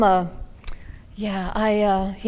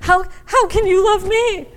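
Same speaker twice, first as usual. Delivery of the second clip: mock plea; 11 semitones higher, 5 dB louder than usual. Repeated mock plea, far voice.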